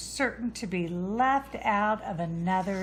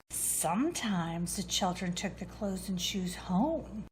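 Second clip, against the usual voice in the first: ordinary delivery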